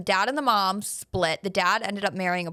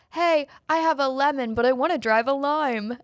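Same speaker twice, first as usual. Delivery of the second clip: higher-pitched schoolkid on the phone; prank-calling kid; 6 semitones higher, 3 dB louder than usual. Retold or imitated. Kid prank-calling a car service, imitated high voice. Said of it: "he was, like, doing a prank call".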